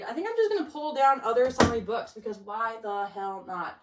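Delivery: ordinary delivery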